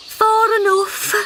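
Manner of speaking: slow, high, tired mother voice; too tired to go further; puffed-out mother frog. Acted.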